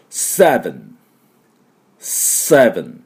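seven with sharp S, buzzed V, open-lipped N; model "seven". Shown as a demonstration. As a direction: model seven slowly for repeating. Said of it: "ちゃんとくっつかないようにね". (唇を閉じないお手本のseven)

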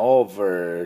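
American "over" with a held R; American accent. Shown as a demonstration. Teacher comparing accents for learners, American "over". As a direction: curl the final R of "over", American style. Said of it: "アメリカ人はoverって言いますが".

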